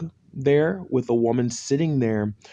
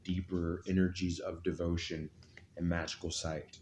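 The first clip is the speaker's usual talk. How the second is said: mystical horoscope reading; 4 semitones lower, 7 dB quieter than usual. Acted. Hushed devotional drawing ritual.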